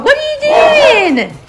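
high and loud, amused; excited high call. Real, not acted. Owner calling to the dog, high and amused.

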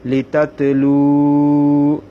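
slow "লিতাতলু" with long held final u; one-alif lengthening. Shown as a demonstration. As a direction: recite "লিতাতলু", drawing out luu loudly and steadily. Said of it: "এক আলিফ টানতে হবে".

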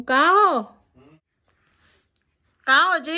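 loud, high call across the house. Real, not acted. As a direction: call him from the other room. Calling husband from another room.

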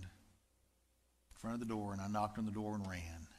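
choked up and quiet, real feeling; getting emotional. Real, not acted. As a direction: voice dropping soft with real emotion. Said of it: "sorry, I'm getting emotional here".

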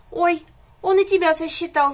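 high, loud mock sob of a little calf; sobbing. A narrator's reading. Calf sobs as the pig is counted, high and loud. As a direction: gulp it out through a mock sob. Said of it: "всхлипнул телёнок".

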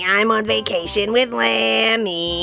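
low, slow teasing panda. Acted. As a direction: tease like a sly stowaway. Panda tells her trick.

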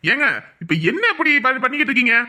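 exasperated shouting. Acted. Man demanding the women stop fighting, exasperated shout. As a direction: raise the voice into an angry demand.

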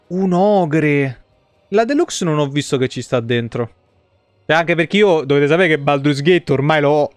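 ordinary delivery

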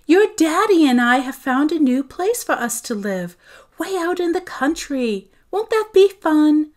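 high, bright sing-song cheer; forced enthusiasm. A narrator's reading. Mother selling the move to her daughter, overly cheerful. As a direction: sell it with put-on cheer. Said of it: "infusing her voice with enthusiasm".

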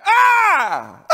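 high, loud cry of fervor; preacher's cry. Real, not acted. Preacher crying out to the church mid-verse, loud high cry. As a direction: let out a sudden shout of praise.

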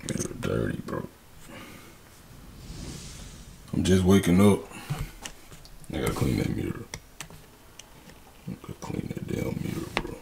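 low, quiet just-woke-up voice; rasping fresh out of bed; morning voice. Real, not acted. Vlogger waking up, groggy morning voice. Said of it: "excuse my voice".

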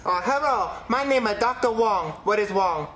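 low-pitched doctor with a put-on Chinese accent; mock Chinese accent. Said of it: "I called a Chinese restaurant".

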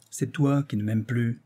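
ordinary delivery

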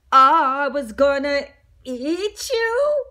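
slow, stunned, stretched-out answer; shocked reply. Acted. Mister Bing replies to the talking donut, slow and shocked. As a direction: answer slowly, taken aback. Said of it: "he replied in shock".

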